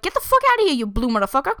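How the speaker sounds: sudden high, loud brush-off; rude New Yorker yell. Retold or imitated. New Yorker yelling at an alien, loud and high. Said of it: "and be like".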